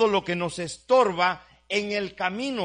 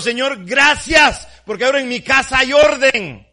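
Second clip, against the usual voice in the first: shouted thanks; 6 semitones higher, 12 dB louder than usual. Retold or imitated. Shouted thanks after cleaning.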